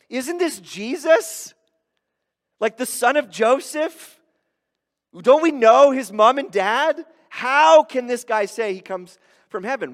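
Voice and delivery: high, loud mock disbelief; incredulous crowd. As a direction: challenge it like a scandalized neighbour. Acts the sceptical crowd's questions.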